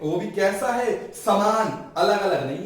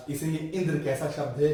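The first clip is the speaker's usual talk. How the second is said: ordinary talk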